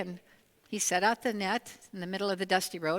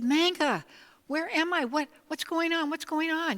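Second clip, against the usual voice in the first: high, louder, bewildered waking cry; surprised judge; 9 semitones higher, 4 dB louder than usual. A narrator's reading. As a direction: wake up confused and alarmed. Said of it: "he was so surprised".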